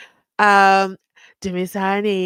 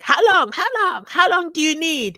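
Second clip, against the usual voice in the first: higher and louder, demanding; impatient judge; 9 semitones higher, 5 dB louder than usual. Retold or imitated. Host mimicking the judge, loud and impatient. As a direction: press hard for an answer like a fed-up judge. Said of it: "my lord, was like".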